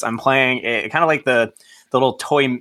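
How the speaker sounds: usual speaking voice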